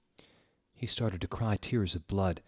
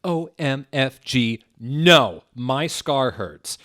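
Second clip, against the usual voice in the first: screamed; 4 semitones higher, 7 dB louder, slower than usual. A narrator's reading. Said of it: "he started to scream".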